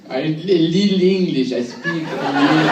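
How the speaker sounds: put-on slow, halting English with a French accent; French accented broken English.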